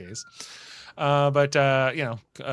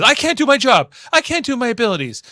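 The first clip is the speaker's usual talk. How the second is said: higher, louder, desperate lament; mock distressed complaint to the doctor; 10 semitones higher, 7 dB louder, faster than usual. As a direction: plead your helplessness. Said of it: "goes to see Doctor Crusher and says".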